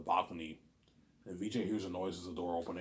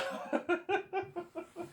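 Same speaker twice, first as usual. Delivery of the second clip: squealing laughter; high laugh. Real, not acted. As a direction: laugh hard.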